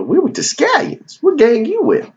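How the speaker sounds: a hammed-up high gangster voice; street gang tough. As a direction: pitch it up like a cocky gang kid. Gang member voiced in story.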